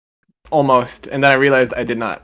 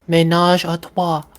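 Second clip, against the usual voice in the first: higher-pitched French accent; French pronunciation; 5 semitones higher than usual. Shown as a demonstration. Friend repeating how the French say it, higher French accent. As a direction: say it carefully with a French lilt. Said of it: "That's how they say it".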